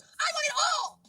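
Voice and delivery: very high, drawn-out mock yell; retold loud demand. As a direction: imitate a kid wailing for everything. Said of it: "Not that loud, but it was loud".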